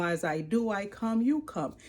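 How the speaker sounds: normal talking voice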